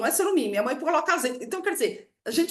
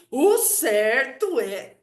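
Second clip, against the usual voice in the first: "O certo é" pounded out very slowly; emphatic insistence; 4 dB louder, much slower than usual. Retold or imitated. Dad overruling a plea to plant here, slow and emphatic. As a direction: hammer "certo", stretching every word. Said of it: "ele enfatiza aquilo".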